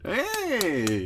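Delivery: high, drawn-out Muppet "hey"; Muppet-like call. Pretend. Podcaster hailing a guest's arrival, Muppet voice. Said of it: "Which Muppet was that?".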